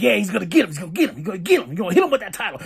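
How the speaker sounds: hyped chant of prediction; excited anticipation. Retold or imitated. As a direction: rattle it off fast, eager. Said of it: "I was like".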